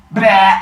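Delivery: loud mock retch; retching noise. Kronos throws up in front of Zeus, loud retch. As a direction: make a loud throwing-up sound. Vomiting sound.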